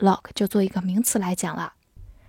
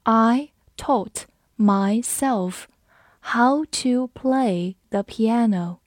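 very slow, deliberate word-by-word delivery; slow reading; much slower than usual. Shown as a demonstration. Teacher models piano sentence for learners, slow reading. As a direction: stretch it out slowly with clear gaps. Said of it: "我们慢读一遍".

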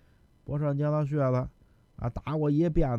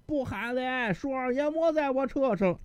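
calling out; 9 semitones higher than usual. Acted. Carter answers, calling again.

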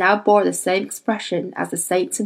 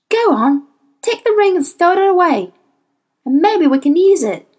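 higher voice, pressing and firm; urgent daemon Hester; 10 semitones higher, 4 dB louder than usual. Acted. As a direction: talk Lee into taking the ring. Hester voiced, urging Lee.

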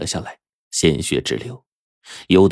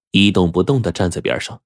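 usual speaking voice

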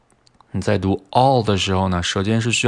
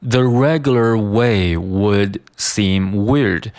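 "The regular way would seem weird" slow, each word distinct; slow careful reading; much slower than usual. Shown as a demonstration. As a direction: spell out "would" in full, unhurried. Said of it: "它的速度会被读得非常的快". (慢速逐词朗读)

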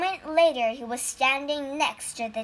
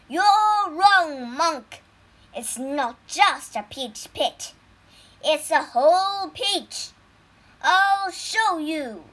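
gloating; 4 semitones higher, 5 dB louder than usual. Acted. Gloating tiger immortal.

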